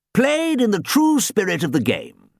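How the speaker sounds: lightly voiced old gentleman; a hint of a fussy old coach. Archie the ghost coach speaks.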